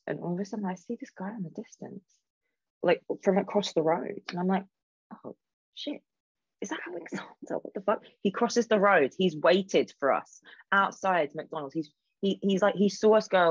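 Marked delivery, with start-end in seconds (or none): 5.2-8.0 high, hushed shock at spotting him; shocked hushed whisper. Retold or imitated. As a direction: gasp it under your breath, startled. Spotting the man across road.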